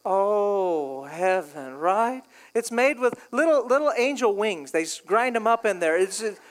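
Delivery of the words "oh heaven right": mock bliss. Preacher craving an orange creamsicle shake, mock bliss. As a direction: sink into it, savoring something heavenly. Swooning over a milkshake.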